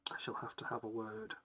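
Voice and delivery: hushed aside to himself; whisper. Real, not acted. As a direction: whisper it under your breath. Muttering to self.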